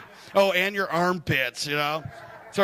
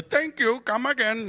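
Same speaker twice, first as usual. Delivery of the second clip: put-on Indian accent; 6 semitones higher than usual. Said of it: "I worked with a bunch of Indian dudes".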